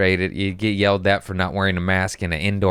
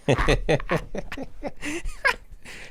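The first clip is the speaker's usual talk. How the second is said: high giggle; 4 dB quieter than usual. Real, not acted. Mock apology after joke.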